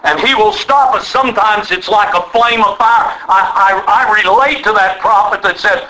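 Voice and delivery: ordinary talk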